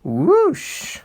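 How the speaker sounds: high, long whoosh of escaping steam. A narrator's reading. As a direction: blow off steam in relief. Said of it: "let off steam with relief".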